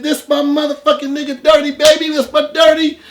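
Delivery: high, loud, laughing hype-man greeting; loud fake-friendly greeting. To the armed man, overjoyed fake-friend shout. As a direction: greet him like a long-lost buddy, way up high. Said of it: "I was still in Jeff mode".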